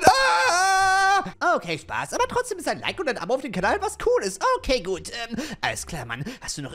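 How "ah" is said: high loud fake shriek; jump-scare yelp; hammed-up scream; mock scream. Fake scare at giant potato.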